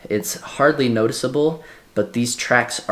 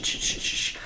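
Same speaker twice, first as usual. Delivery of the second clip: shaker sound; 8 dB quieter than usual. Retold or imitated. Imitating the shaker sample sound.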